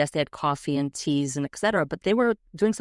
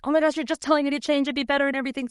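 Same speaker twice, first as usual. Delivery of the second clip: put-on exasperated girls; 9 semitones higher, much faster than usual. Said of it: "it's like".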